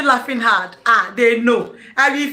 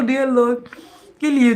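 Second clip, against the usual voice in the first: ordinary delivery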